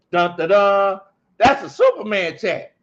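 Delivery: loud, high fanfare announcement; Coach Ronnie impersonation. Acted. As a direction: belt out a stiff, hammed-up superhero announcement. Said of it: "that was my Coach Ronnie impersonation".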